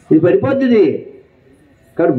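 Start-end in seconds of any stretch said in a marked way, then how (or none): none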